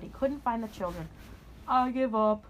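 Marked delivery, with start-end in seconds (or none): 1.7-2.4 giving up. Acted. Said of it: "he said".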